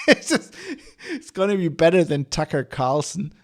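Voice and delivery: laughing through the words. Real, not acted. Podcaster taking a jab at US news, laughing. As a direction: break into a chuckle mid-sentence. Laughs at his own jibe.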